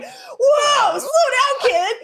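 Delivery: high laughing yell; hammed-up alarm, shouted; mock shout. Character voiced shouting.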